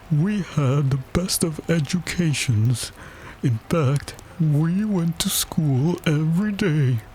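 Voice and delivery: high, solemn storyteller's voice; mournful turtle reminiscing; doleful Mock Turtle. A narrator's reading. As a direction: boast slowly and wistfully. Mock Turtle boasts of his education.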